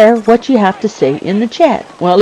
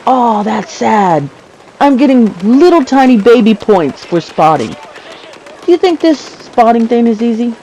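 usual speaking voice

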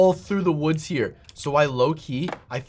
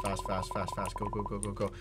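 hurried murmur; 11 semitones lower, 15 dB quieter than usual. Real, not acted. Rushing to shelter in rain.

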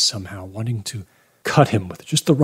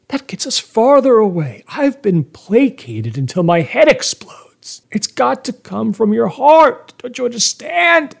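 high, loud, desperate insistence; explode, pitch climbing to a pleading cry; exasperated outburst; 13 semitones higher, 4 dB louder than usual. A narrator's reading. Man rejecting the priest's placating, exasperated outburst.

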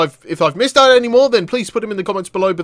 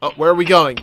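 usual speaking voice

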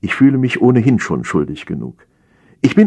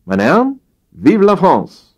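high, proclaimed French battle cry; Napoleon's ringing patriotic toast; rallying cry; 11 semitones higher, 4 dB louder than usual. A narrator's reading. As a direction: proclaim it to the troops, pitch raised. Napoleon finishes his interrupted speech.

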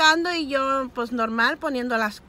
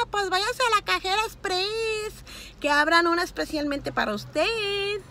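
mock retort; 7 semitones higher than usual. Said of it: "ya les iba a decir".

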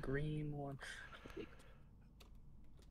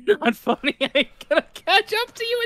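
high loud laughing complaint; laughing protest; 8 dB louder than usual. Real, not acted. Teased after failing.

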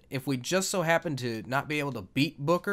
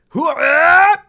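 very loud, high held yell; wrestler's war cry; 17 dB louder than usual. Acted. Gamer hollering along with the wrestling, huge high war cry. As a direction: belt out a ringside holler.

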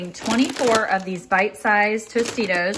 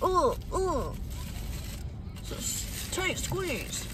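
talking for the car; 8 semitones higher, 8 dB quieter, slower than usual. Pretend. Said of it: "you talk for your car".

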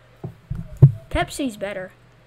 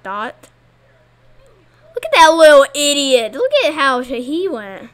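ordinary talk, nothing marked

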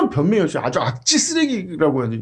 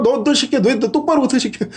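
higher mock scolding; donkey talking back; 5 semitones higher than usual. Donkey scolding its rider, mild mock anger. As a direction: snap at him like a sassy donkey. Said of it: "그것도 따져".

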